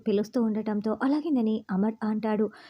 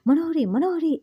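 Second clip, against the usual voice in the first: raised, higher-pitched narrated call; calling out loudly; 6 semitones higher, 5 dB louder than usual. A narrator's reading. Maid Chemba runs in calling Manohari, mildly shouted. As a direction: call the name twice, louder and higher. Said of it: "గట్టిగా కేకలు పెట్టడంతో".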